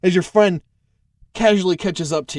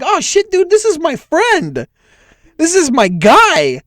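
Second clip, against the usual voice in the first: high, slow, delighted greeting to a buddy; excited recognition; 12 semitones higher, 7 dB louder, slower than usual. Acted. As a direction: call out happily to an old friend, drawn out. Guard spots his charmed friend.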